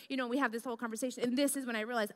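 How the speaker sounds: usual speaking voice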